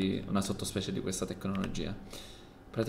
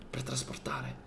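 whisper; 9 dB quieter, slower than usual. Acted. Secretive plans.

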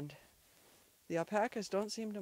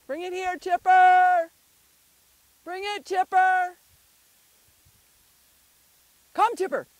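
calling out; 10 semitones higher, 16 dB louder than usual. Real, not acted. Owner calling dog back with the ball, calling out. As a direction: holler the name across a distance.

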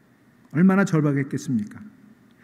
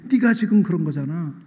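mocking shout; 5 semitones higher than usual. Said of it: "그 꼴이 너무 우스운 거예요".

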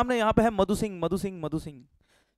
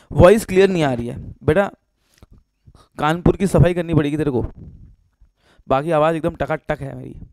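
loud; 3 semitones lower, 9 dB louder than usual. Real, not acted. Student says voice unclear.

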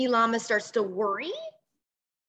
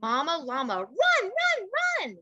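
excited urging; 7 semitones higher than usual. Acted. Urging mama llama on.

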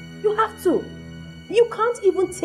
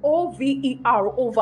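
emphatic spelling out. Acted. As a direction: spell it out so he gets it. Spells out that it is over.

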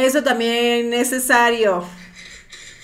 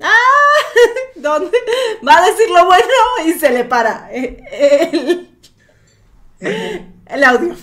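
laughing; 6 semitones higher, 5 dB louder than usual. Real, not acted. Host cracks up at glitch.

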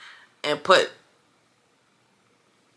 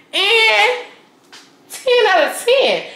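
excited rating; much slower than usual. Real, not acted. Repeating her rating, much higher.

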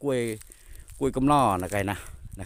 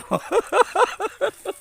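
high laugh; 4 dB louder than usual. Real, not acted. Laughing at the cold.

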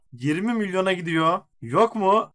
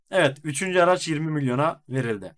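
usual speaking voice

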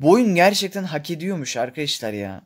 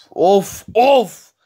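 exasperated groan; 4 semitones higher, 7 dB louder than usual. Real, not acted. Fed up with the game.

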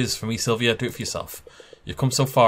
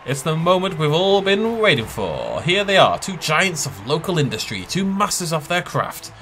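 higher, slowed, showy announcer read; 6 semitones higher than usual. Acted. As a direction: hype it up like a ring announcer. Reading the game announcer's line.

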